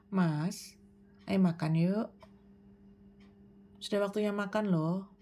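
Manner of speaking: low "Mas", pause, then "ayo makan yuk"; paused, low delivery. Shown as a demonstration. Parent calling a child to eat, low with pauses. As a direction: leave gaps after "Mas" and "yuk", keep it low. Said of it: "Atau Anda bisa juga memberi jeda".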